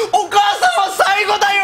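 confused junior blurting on live radio; flustered junior. Retold or imitated. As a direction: blurt it out in panic. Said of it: "ヨシタカも困惑したんでしょうね".

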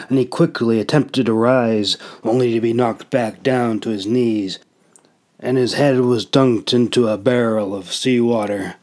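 usual speaking voice

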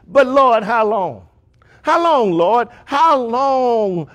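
insistent high plea, repeated and stretched; loud crying out. Acted. Souls asking God when He will avenge them, crying out. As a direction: call up to the Lord, high and urgent. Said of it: "they cried with a loud voice".

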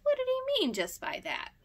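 very high bewildered question; puzzled Peter. A narrator's reading. Peter baffled about Johnny Chuck, bewildered. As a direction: ask in wide-eyed wonder.

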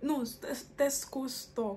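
normal talking voice

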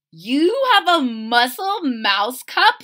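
thrilled discovery; Lily amazed at Chester's cup; delighted girl. Acted. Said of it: "said Lily".

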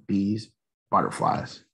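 plain reading voice

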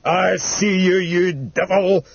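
high, drawn-out cry at the devil; raving shout. Acted. Dying old man seeing hell in the fire, raving shout. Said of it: "he shouted".

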